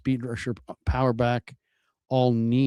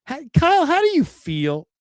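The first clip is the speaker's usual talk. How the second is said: much higher, louder sappy question; mock reporter; 5 dB louder than usual. Host mocking a reporter asking Kyle, high mock voice.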